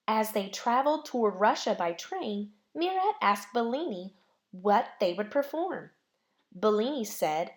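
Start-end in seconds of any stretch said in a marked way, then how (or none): none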